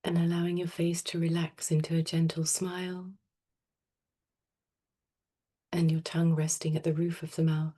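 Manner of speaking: soft, unhurried meditation voice with long pauses; guided meditation. Real, not acted. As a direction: gently guide them into stillness, phrase by phrase. Leads a breathing meditation.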